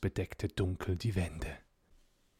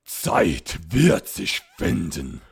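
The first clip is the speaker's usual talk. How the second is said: slow, loud, lower ghostly command; 3 semitones lower, 10 dB louder, slower than usual. A narrator's reading. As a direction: lay down the law, slow and heavy. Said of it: "rief gebietend".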